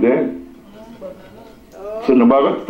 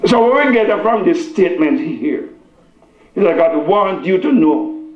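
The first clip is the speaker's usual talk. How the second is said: higher-pitched, emphatic preaching; 6 semitones higher than usual. Real, not acted. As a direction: drive the message home to the congregation. Preaches to congregation.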